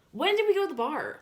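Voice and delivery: very high, loud disbelief; incredulous outburst. Real, not acted. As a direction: burst out loudly, calling the claim absurd. Incredulous at the friend's claim.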